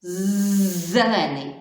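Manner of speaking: «з-» buzzed loud, then «зелений» with the З drawn out; stretched first sound. Shown as a demonstration. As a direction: buzz the «з» hard, then say «зелений». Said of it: "перший звук". (протяжне дзвінке «з» у слові «зелений»)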